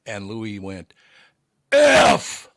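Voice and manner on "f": sudden high, explosive yell; top-of-the-lungs shout. Retold or imitated. Louis after the missed shot, yelling at the top of his lungs. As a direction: belt it out at full volume. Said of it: "at the top of his lungs".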